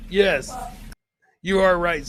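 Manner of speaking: ordinary delivery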